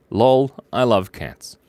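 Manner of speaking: usual speaking voice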